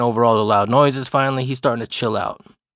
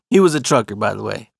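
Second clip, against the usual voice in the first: usual speaking voice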